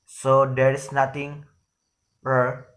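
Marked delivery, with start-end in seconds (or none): none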